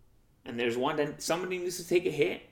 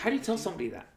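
ordinary talk, nothing marked

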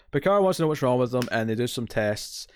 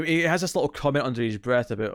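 normal talking voice

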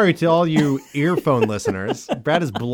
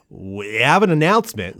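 retching announcer; 2 semitones lower than usual. Shown as a demonstration. Demonstrating the announcer's word start, retching announcer voice. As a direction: stretch WE as if about to throw up. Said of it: "sounds like they're about to puke".

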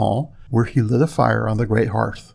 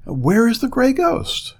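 high, urgent astonished question; disbelieving, pitched-up surprise; 11 semitones higher than usual. Acted. Prince astonished to find sweetheart.

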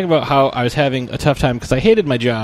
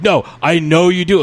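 mild mock yell; 5 semitones higher, 4 dB louder than usual. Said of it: "she was getting mad and yelling at me".

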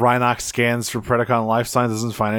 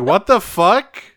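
mock exasperation at getting no reaction; baffled Rat Trap; 8 semitones higher, 3 dB louder than usual. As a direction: Rat Trap puzzled that Dinobot won't fight back. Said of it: "And Rat Trap's like".

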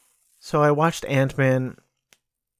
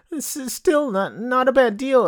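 mocking, pitched way up; 12 semitones higher than usual. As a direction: sneer it high like a gullible fan. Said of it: "the attitude of these people who say".

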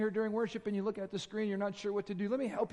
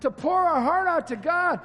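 louder, higher fervent preaching; full-force preaching; 6 semitones higher, 9 dB louder than usual. Real, not acted. Pastor exhorting his congregation, fervent loud preaching. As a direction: preach it out with rising urgency.

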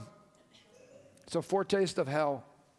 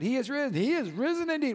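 lifted, ringing high proclamation; Easter proclamation; 8 semitones higher than usual. Acted. Pastor proclaiming the Easter greeting to the church, lifted and ringing.

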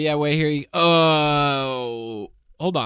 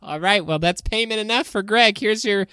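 high, lightly voiced Wendy; 7 semitones higher than usual. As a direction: play Wendy with a raised voice. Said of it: "it's me, Wendy".

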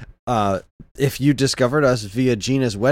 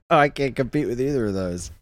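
ordinary talk, nothing marked

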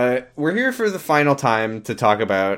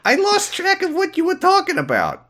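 much higher cartoon rat voice, quick on the second phrase; squeaky rat voice. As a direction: stay in the tiny rat's voice. Sunglasses character voice.

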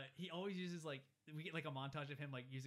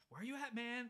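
calling Hogarth; 10 semitones higher than usual. Retold or imitated. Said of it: "Dean's like".

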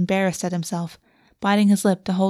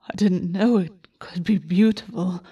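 remembered quote. Retold or imitated. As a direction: recall the words to himself. August recalls the words.

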